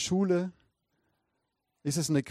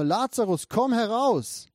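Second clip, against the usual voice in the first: raised, drawn-out command; loud calling out; 6 semitones higher, 4 dB louder, slower than usual. A narrator's reading. Jesus calling dead Lazarus from the tomb, loud voice in a reading. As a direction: call it out higher and slowly, as a narrated summons. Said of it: "rief er mit lauter Stimme".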